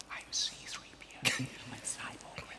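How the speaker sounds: real whisper, barely audible. Real, not acted. Someone feeds the contestant his audition line, whispered. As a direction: whisper it like a prompt in the ear. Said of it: "He had someone whispering in his ear".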